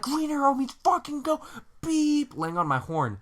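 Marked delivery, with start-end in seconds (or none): 0.0-1.4 high furious shout; road rage yell. Retold or imitated. Driver shouting at the lady waiting at the green arrow, high angry yell. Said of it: "I'm like". 1.8-2.4 high short beep; horn honk. Retold or imitated. Laying on the horn at the slow driver, high beep.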